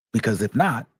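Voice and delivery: ordinary delivery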